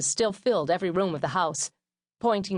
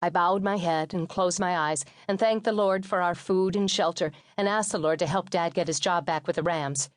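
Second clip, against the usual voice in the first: ordinary delivery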